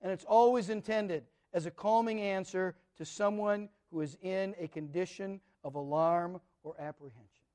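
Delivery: usual speaking voice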